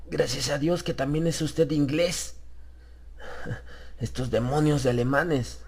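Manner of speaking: clutching at relief through the fear; shaken Harris. Acted. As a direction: Harris gasping thanks to a fellow Englishman.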